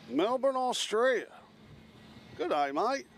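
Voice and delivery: mock Australian accent. Greeting the Melbourne viewer back in a mock Australian accent. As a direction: greet the viewer like a hammed-up Aussie. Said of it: "always makes fun of my Australian accent".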